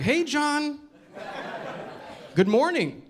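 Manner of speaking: high, cheery nice-neighborhood greeting; polite neighbor greeting. Retold or imitated. Nice neighbor greeting you on the street, bright and polite. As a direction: chirp it like a friendly neighbor. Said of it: "they're like".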